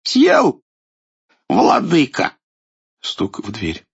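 put-on high, loud old king voice; cheeky old tsar. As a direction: gloat at the villain. Царь дерзит идолу. (дерзкий старый царь)

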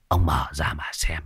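low, soft hissed taunt; sinister whisper. Acted. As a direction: whisper it like a menacing sorcerer. Sorcerer taunts elder. (thầy Khoan thì thầm đầy đe dọa)

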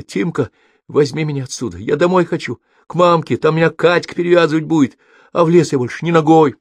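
put-on high, plaintive child begging for home; tearful pleading. A narrator's reading. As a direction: beg like a crying little boy. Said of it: "заплакал Китька". (плаксивая детская мольба)

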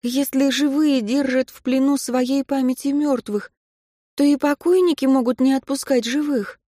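a hint of a hurt young woman; Katya, a young woman. A narrator's reading.

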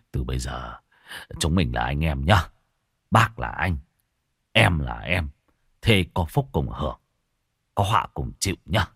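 tipsy, slurred buddy talk; drunken slur. Acted. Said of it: "giọng lè nhè".

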